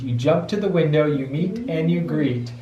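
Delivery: usual speaking voice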